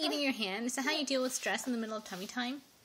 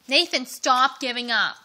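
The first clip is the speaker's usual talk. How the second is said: loud, drawn-out urging; firm coaching; 8 dB louder, slower than usual. Real, not acted. Parent urging baby to keep trying, firm. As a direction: stretch the words out loudly and firmly.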